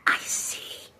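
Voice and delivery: hushed, teasing whisper; mock-creepy whisper. Vlogger teases the man with the earpiece, whispering. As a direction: whisper it slowly.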